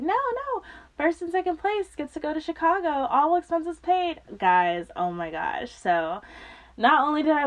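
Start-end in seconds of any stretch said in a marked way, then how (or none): 0.0-4.2 high-pitched, eager explanation; excited reassurance. Retold or imitated. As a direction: correct someone eagerly with good news. Acts out eager explanation to her.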